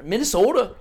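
"Minnesota" in a loud Minnesotan accent; put-on Minnesotan. Shown as a demonstration. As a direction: lean on the long o of "Minnesota" like a Minnesotan. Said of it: "That's why I went with that".

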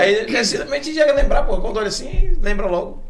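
high, loud, eager telling; excited. Real, not acted. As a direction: push it up with excitement. Lively excited point.

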